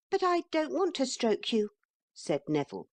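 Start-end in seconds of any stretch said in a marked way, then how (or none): 0.1-1.7 put-on much higher, quick little boy voice; a bold little boy squaring up; little boy threatening a horse, high and fast; small boy. A narrator's reading. Neville replies.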